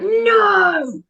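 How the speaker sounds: high, drawn-out shouted "no"; really loud no. Retold or imitated. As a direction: re-enact a commanding yell to a dog. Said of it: "it's a really loud".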